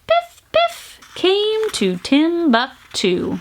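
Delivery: slow, drawn-out arrival; slowing to a stop. A narrator's reading. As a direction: let the little train roll in. Tiny train slows in.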